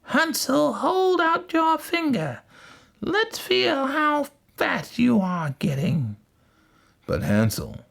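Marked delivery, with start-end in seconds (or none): 0.0-6.2 very high, slow witch voice; wicked witch. A narrator's reading. Witch checking Hansel in the shed, high slow witch voice. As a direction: draw the order out in a high witch voice. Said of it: "the witch went to the shed and said".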